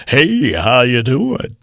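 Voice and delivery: slow, hammed-up radio announcer voice; phony DJ voice. Shown as a demonstration. Coach showing how a puker greets you at a party. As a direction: croon it like a cheesy eighties DJ. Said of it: "if someone came up to you at a party and said".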